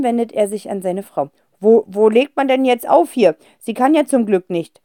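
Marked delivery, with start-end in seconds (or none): none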